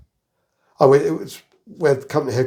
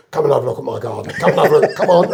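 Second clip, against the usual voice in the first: eager invitation; 5 dB louder, much faster than usual. Retold or imitated. He used to say to everybody.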